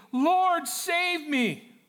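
acted high, loud cry for help; desperate cry. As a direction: cry out as if sinking. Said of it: "he cried out".

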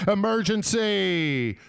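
drawn-out, high imitated yell; imitated alarm cry, stretched out high; top-of-lungs yell. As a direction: holler it slowly to the whole restaurant. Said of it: "he yells at the top of his lungs".

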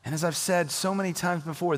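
normal talking voice

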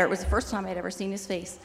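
ordinary delivery

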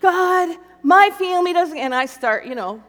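high and loud, pouring out hurt; angry complaint to God. Retold or imitated. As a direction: dump every grievance on God at once. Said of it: "dump truck loading what I'm feeling".

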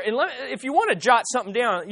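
ordinary talk

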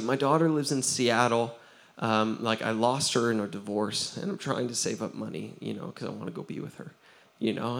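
tearful confession. Retold or imitated. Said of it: "he just started crying".

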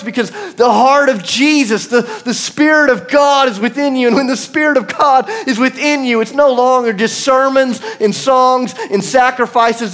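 high, loud, driving sermon delivery; full-force preaching. Real, not acted. Preacher affirming his friend's calling to the room, impassioned preaching. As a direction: preach it hard, hammering each phrase.

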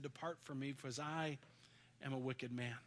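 low, hushed plea of an unworthy man; hushed confession. Retold or imitated. Preacher voicing Peter's confession to Jesus, hushed. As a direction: confess it under your breath.

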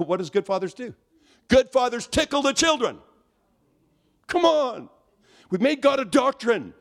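high, loud, impassioned preacher's cry; full-force preaching. Real, not acted. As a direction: drive it home to the congregation at high pitch.

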